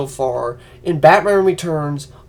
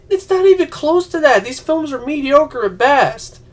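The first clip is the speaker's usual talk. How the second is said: retorting to fans; 8 semitones higher than usual. Retold or imitated. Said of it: "And I'm like".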